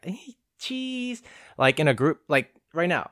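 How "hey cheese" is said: high forced cheese; awkward forced cheese; forced photo smile. Shown as a demonstration. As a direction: fake a camera smile. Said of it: "I can't smile like on command".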